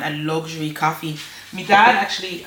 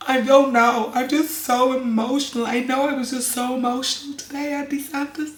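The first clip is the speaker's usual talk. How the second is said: higher little-girl voice, imitating a five-year-old; aunt mimics her tearful little niece, higher voice; 8 semitones higher than usual. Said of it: "she was like".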